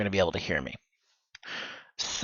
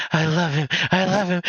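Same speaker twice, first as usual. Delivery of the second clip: rising higher and louder, obsessive; creepier yandere; 8 semitones higher, 4 dB louder than usual. Shown as a demonstration. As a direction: build the obsession up, getting creepier. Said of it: "Just get creepier and creepier".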